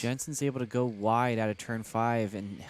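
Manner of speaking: excited live race call; motorsport commentator. Real, not acted. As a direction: call the pass as it happens.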